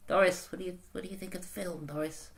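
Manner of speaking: lightly voiced chatty old lady; a hint of a dotty old lady asking her friend. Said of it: "It's just my old person voice".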